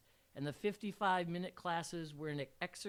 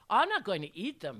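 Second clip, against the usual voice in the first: louder, protesting promise from a student; promising; 3 semitones higher, 8 dB louder than usual. A narrator's reading. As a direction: insist on your innocence, a bit loud. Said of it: "he promised".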